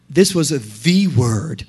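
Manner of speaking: "the" said as an emphatic, drawn-out "thee"; slow down and lean on "the"; stress on THE. Real, not acted. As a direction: make "the" a long "thee" before "word". Singles out THE word, meaning Jesus.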